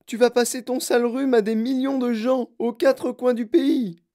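high, loud motherly reproach; yelled scolding. A narrator's reading. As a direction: yell a scolding at your child. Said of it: "hurla madame Gloop".

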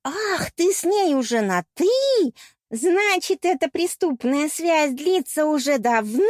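high, slow, theatrical accusation; jealous accusation. A narrator's reading. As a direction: draw out each accusation in jealous shock. Jealous mermaid keeps accusing the couple. (ревнивое обличение)

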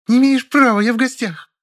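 very high, loud protest; shrill defiance. A narrator's reading. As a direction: shriek your rights. Woman shrieks in quarrel. (визгливый протест)

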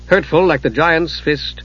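ordinary delivery, nothing marked

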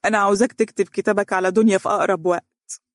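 earnest plea; grieving mother pleading with Fares. Acted. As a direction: beg him gently but firmly. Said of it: "فتبعت برجاء".

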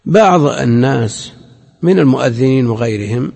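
ordinary talk, nothing marked

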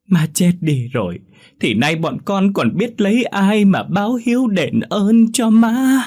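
loud, high mock lament rising to a cry; fake wailing. A narrator's reading. Relatives pretending grief at the funeral, loud false wailing. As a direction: overact the mourning for the crowd. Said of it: "giả tạo quỳ gối ... mà gào khóc".

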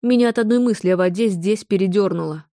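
ordinary delivery, nothing marked

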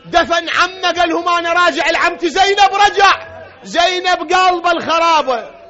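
high, loud preacher's cry; full-force lament sermon delivery; impassioned mourning narration. A narrator's reading. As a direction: drive the story to its climax.

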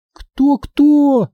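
very high incredulous cry; high squawked question. A narrator's reading. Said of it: "закричала Минди".

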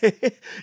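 short, high, rising laugh; the young man laughs at the constable, high and rising; defiant laugh. Acted. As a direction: laugh off the threat. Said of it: "lachte de jonge man van Fantine".

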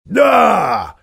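loud, high bitter snap; snapping back. A narrator's reading. Dwarf snaps at the badger about his people, loud bitter snap. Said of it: "огрызнулся Никобрик".

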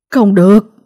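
sharp, loud cry of no; loud shout. A narrator's reading. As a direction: yell to stop the killer. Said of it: "Ông Hạnh hét lớn". (hét lớn ngăn cản)

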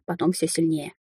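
ordinary delivery, nothing marked

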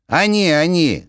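high, loud bleating goat voice. Acted. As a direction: sing it out like a goat. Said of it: "козлиным голосом запел".

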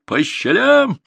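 very high, loud yell; triumphant shout. A narrator's reading. Grandson jeers after fleeing raiders, shouting. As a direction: holler it after the fleeing enemy. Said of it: "крикнул Артур".

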